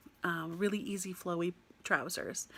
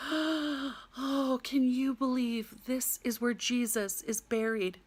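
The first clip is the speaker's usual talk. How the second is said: great big sigh; 5 semitones higher, much slower than usual. Retold or imitated. Woman at the basilica marvelling at Jesus's burial place, imitated big sigh. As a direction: gasp in, then slow, awestruck sighing. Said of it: "she has this great big sigh".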